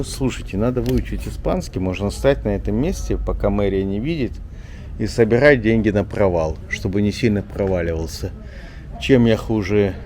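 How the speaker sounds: ordinary delivery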